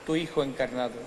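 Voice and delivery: ordinary talk, nothing marked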